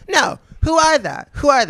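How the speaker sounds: high, loud mock outburst of a shocked mom; shrill-free but loud demand, voice jumped up; imitating mom's outburst. Mom voiced loud and high demanding names.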